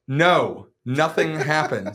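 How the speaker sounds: loud, slow, drawn-out protest; mock exasperated denial. Bar host denying a scandal to the girl, loud mock exasperation. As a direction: push each word out loudly.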